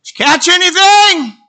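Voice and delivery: high, loud call across the water; hollering. Acted. Man on the shore calling to fishermen, loud holler. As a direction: holler it out to someone far away. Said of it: "he hollered at him".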